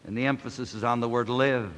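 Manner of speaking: usual speaking voice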